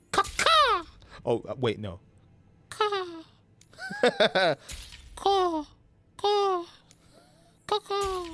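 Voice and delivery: high squawking crow caws, laughing in between. Pretend. Streamer cawing at his new crow outfit, high squawks. Cawing at the Silent Caw item.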